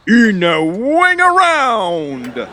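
drawn-out, very slow reverent naming; grand slow announcement. Acted. Salesman proudly naming his invention, slow and grand. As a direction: savor every syllable like a brand launch.

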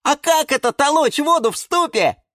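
puzzled little seedlings asking; surprised kids. A narrator's reading. Seedlings ask further.

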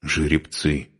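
understated disgruntled mutter; mildly grumpy aside; grumbled. A narrator's reading. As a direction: grumble it under your breath. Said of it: "буркнула женщина".

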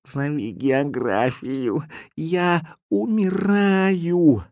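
soft, very slow dying plea; dying cat. A narrator's reading. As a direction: gasp it out weakly.

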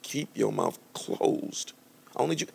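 soft, slow commanding divine voice; voice of the Holy Spirit. Retold or imitated. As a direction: hush him like a stern voice from heaven. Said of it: "the Holy Spirit was just like".

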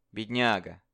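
ordinary delivery

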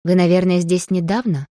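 usual speaking voice

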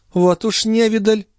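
high, dismissive lady's voice; peevish countess. A narrator's reading. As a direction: brush it off peevishly, pitched high. Countess in a bad mood.

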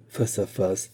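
ordinary delivery

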